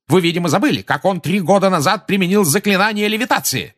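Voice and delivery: sustained high, loud indignation; furious official piling up accusations; yelling tirade. A narrator's reading. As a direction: rant on without letting the pitch come down. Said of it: "заорал Фадж".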